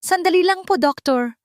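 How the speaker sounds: high, loud cut-in; sharp interruption. Acted. Eliza interrupting the doctor, high and loud. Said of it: "Muling sumingit si Eliza".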